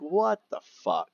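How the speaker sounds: slow, high, drawn-out what the; retold shock at being kicked out; stunned disbelief. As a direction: let the shock stretch out high.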